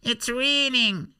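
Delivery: very high, slow falsetto whine; wailing comic character. Acted. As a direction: wail the complaint in a squeaky mock cry.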